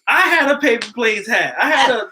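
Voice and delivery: loud, high-pitched protest; heated rebuttal. Real, not acted. Heated rebuttal in friendly debate.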